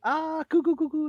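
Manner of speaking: put-on high cooing baby talk; high sing-song cooing at an infant. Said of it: "like that, right?".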